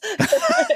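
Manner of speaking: short, high laugh bursting out; sudden high giggle; high burst of laughter. Real, not acted. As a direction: laugh out at the admission. Laughs at own confession.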